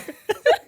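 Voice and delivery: squeaky stifled giggle; high-pitched giggle. Real, not acted. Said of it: "trying not to laugh".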